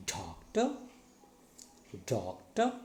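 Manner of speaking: "doctor" with long firm DOC, light rising ta; first-syllable stress. Shown as a demonstration. Teacher models for learners to copy, "doctor" stressed on DOC. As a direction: lean on DOC in each "doctor". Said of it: "stressing the first syllable".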